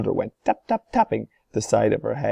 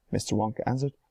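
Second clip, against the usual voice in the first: plain reading voice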